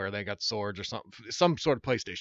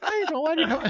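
very high, whining knight voice through laughter; whiny knight voice; 4 dB louder than usual. Retold or imitated. Laughing as he imitates the ad that used his whiny reading. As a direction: squeal it like the knight in the TV ad. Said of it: "with the same type of voice".

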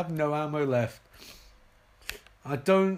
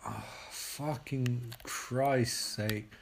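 lower, softer, slow groaning mutter; exasperated mutter; 5 semitones lower, 7 dB quieter, much slower than usual. Real, not acted. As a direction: sigh it out in defeat.

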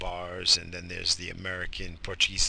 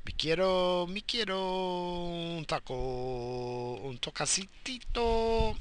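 mock Spanish accent; 8 semitones higher, 4 dB quieter, slower than usual. Silly Spanish voice.

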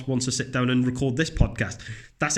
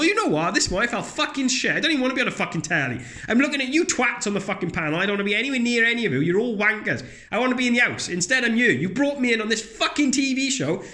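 much higher, ranting, fast in places; fed-up TV guest; 9 semitones higher than usual. Retold or imitated. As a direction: vent at the panel like you hate being there. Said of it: "the person come in and go".

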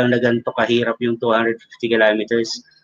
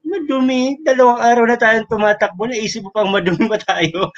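exasperated retort; 7 semitones higher than usual. Retold or imitated.

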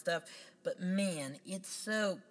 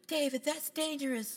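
mock worried woman; 11 semitones higher than usual. Quoting a character's warning.

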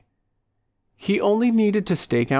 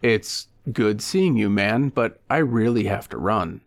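ordinary delivery, nothing marked

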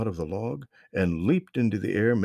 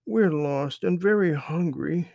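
put-on higher, slow kid voice; tired little girl; 9 semitones higher than usual. A narrator's reading. Lost girl tells the boy they're hungry, slow and high. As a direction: plead slowly like a tired hungry child. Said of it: "said Lauren".

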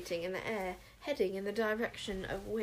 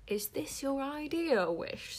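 through gritted teeth; 5 semitones higher, slower than usual. A narrator's reading. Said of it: "through teeth so gritted".

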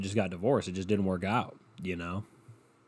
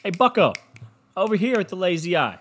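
put-on taunting toddler; 7 semitones higher than usual. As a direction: call out like a rude little kid. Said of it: "They'll be like".

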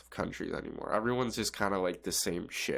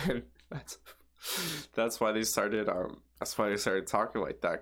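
normal talking voice

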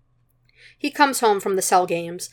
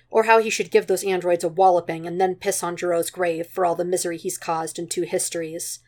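usual speaking voice

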